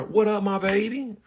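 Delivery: put-on high-pitched but softly easy "What up, my baby?"; cool greeting. Shown as a demonstration. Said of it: "It's cool like that".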